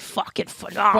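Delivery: imitating a Boston accent. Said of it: "Your Boston accent came out when you said".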